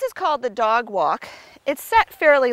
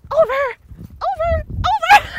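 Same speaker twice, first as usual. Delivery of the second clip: high, louder repeated over ending in a laugh; high urgent cues; 7 dB louder than usual. Real, not acted. Trainer urging Sally over the jumps, high-pitched and laughing. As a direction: push her over, then laugh.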